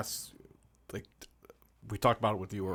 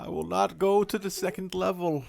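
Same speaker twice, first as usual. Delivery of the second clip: high, loud defiant declaration; defiant movie quote; 9 semitones higher, 6 dB louder than usual. Retold or imitated. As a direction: refuse like a stubborn hero. Quoting the film character.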